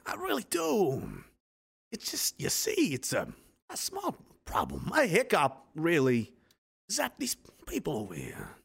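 soft, halting official with swooping pitch; mock government official. Government placating the people it keeps locked up, mock-concerned. Acting out the government's excuses to public.

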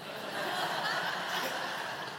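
breathy chuckle at his joke; airy laugh. Real, not acted. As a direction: let out a quiet laugh.

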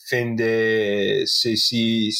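halting French «fin de siècle», drawn out slowly; French phrase attempt. Shown as a demonstration. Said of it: "what's the French term?".

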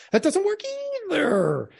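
very high-pitched mocking imitation; exaggerated mimicry. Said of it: "Tone, volume, cadence".

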